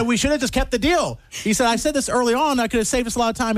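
high-pitched retold protest; mock complaint. Brother to the salesman about the deal, high mock protest.

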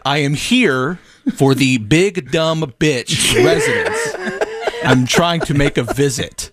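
spelled out slowly for the intercom; slow laughing repeat for a confused stranger; slow, deliberate repeat. Retold or imitated. He goes, "What?.

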